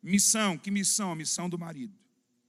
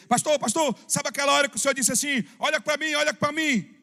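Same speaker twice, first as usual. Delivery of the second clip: higher, rushed voice of an eager churchgoer; imitating a breathless, hurried congregant; hurried excited brother; 6 semitones higher than usual. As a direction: blurt it out fast and high like an excited brother telling the pastor. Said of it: "ele falava bem apressado".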